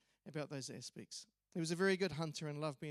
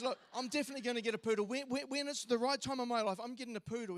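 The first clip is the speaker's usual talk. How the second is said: rattling it off, higher and fast, all in on the poodle; a mate insisting he will get a poodle, fast and high; fast, higher excited insisting; 6 semitones higher, 4 dB louder than usual. Retold or imitated.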